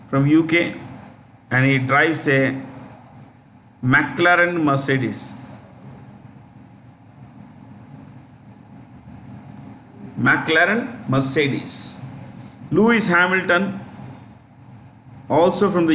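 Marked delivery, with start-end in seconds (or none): 3.8-12.0 slow dictation. Shown as a demonstration. Teacher repeating name for note-taking.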